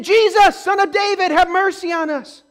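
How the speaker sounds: high, loud plea to Jesus; crying out. Retold or imitated. Blind men crying out to Jesus, loud and high. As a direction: call out desperately for mercy. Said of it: "They said".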